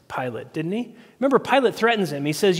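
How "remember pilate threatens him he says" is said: ordinary talk, nothing marked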